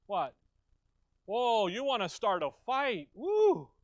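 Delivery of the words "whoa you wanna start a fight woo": high, loud whoop of delight; excited whoop. Real, not acted. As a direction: cheer like a crowd stirred up. Reacting to a provocative verse.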